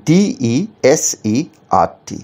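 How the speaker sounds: letters spelled out very slowly one by one; slow spelling out. Shown as a demonstration. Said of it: "সঠিক বানানটা হচ্ছে option এ".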